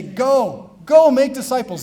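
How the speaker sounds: mock-commanding sending out; commanding call. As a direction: send them out with force. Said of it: "you point and you say".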